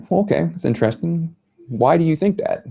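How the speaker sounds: playing a Frenchman with a hint of French accent; fake French accent. Reporter posing as French asks the family why, mock French accent. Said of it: "In my fake French accent".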